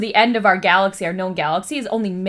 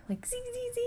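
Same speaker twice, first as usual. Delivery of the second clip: quasar zapping noise; 14 dB quieter, faster than usual. Pretend. Mimicking quasar beam sound.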